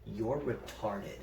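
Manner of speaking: low, slow, quiet anger; quietly seething retort; retold anger. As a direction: say it slowly and under your breath, angry. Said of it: "I was so angry".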